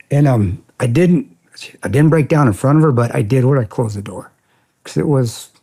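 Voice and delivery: a grown man getting choked up remembering kindness. Real, not acted. Recalling caring woman, voice choking.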